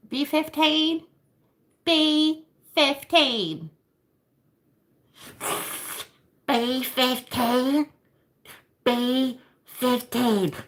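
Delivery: slow, high drawn-out number call; bingo caller. Pretend. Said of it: "Why did I feel the need to be like".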